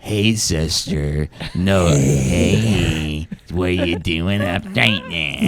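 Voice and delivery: very low put-on sultry voice; mock seductive. Trying erotic audio on a listener, mock seductive. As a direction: drop deep and slow for a hammed-up come-on.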